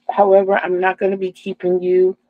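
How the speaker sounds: ordinary talk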